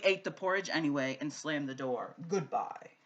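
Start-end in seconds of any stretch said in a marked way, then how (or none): none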